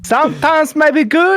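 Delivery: put-on Italian accent. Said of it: "Isn't that basically just".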